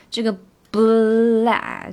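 bla- stretched slow and loud; heavy bla. Shown as a demonstration. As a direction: lean hard on bla. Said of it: "这个地方也是非常的重". (重读bla)

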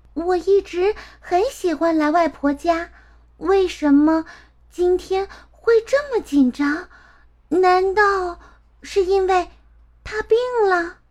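put-on high child voice, worried questioning; uneasy little girl. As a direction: puzzle it out nervously as a small girl. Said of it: "她莫名地感到一阵心慌".

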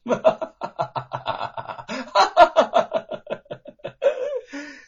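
loud high ringing laughter; hearty guffaw. Acted. Wu Cheng'en laughing at the fooled gatekeepers, hearty guffaw. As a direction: burst out laughing at them. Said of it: "忍不住的哈哈大笑起来".

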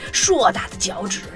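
usual speaking voice

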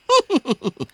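high, falling mocking chuckle; cold laugh. Wukong mocking Sha Seng, cold laugh. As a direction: laugh down at him with contempt. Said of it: "悟空呵呵冷笑".

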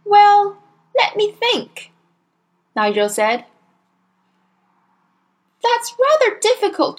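put-on high, innocent child's voice; cheeky small boy. A narrator's reading. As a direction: answer like a cheeky little boy.

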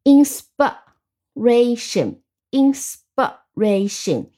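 slow "inspiration", broken as in-spuh-RA-tion; reduced middle vowel. Shown as a demonstration. Teacher models the vowel change, "inspiration" slowly syllable by syllable. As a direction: split "inspiration" with pauses, short uh after sp. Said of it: "发成啊".

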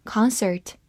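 "concert" with an American open "ah" in the first syllable; American pronunciation. Shown as a demonstration. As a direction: say "concert" the American way, starting "kahn". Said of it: "美式发音". (用美式发音读“concert”，第一个音节读成张开的“啊”)

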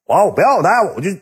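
high, hammed-up bluster; blustering drunk buddy. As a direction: imitate a tipsy big shot making his announcement. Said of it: "当时就五马长枪搁屋".

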